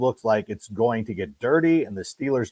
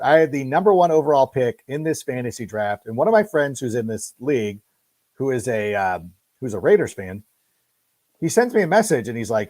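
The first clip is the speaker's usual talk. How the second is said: ordinary talk, nothing marked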